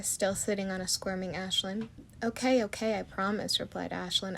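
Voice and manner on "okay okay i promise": ordinary delivery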